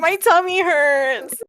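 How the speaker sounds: put-on high, drawn-out kid whine; whining. Sick child complaining of a tummy ache, whining. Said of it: "he's sort of, like, whining".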